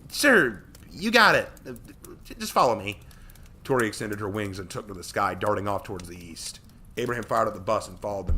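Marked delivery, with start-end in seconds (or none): 0.1-3.0 put-on high, loud flustered girl; hurried awkward reply; embarrassed. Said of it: "a bit embarrassed".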